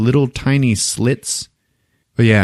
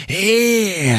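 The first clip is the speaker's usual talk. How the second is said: high creature wail; long, high death cry; imitated dying screech; 10 semitones higher than usual. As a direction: screech like a wounded monster. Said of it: "the thing is like".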